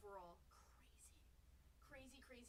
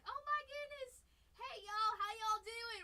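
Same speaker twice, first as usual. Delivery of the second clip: much higher, louder hammed-up hello; mock friend's loud happy hello; imitating Gigi; 10 semitones higher, 13 dB louder, slower than usual. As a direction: squeal a friend's excited greeting. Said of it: "He's like".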